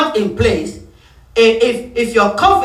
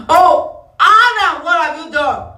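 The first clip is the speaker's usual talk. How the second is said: God calling Adam; 6 semitones higher, slower than usual. Acted.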